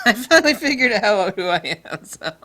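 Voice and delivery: words bursting out on a big laugh. Real, not acted. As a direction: crack up while saying it. Cracks up at his own joke.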